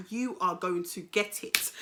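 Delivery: low, slow measured threat; retold warning. Woman warning her man, low and menacing. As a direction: spell out the warning slowly.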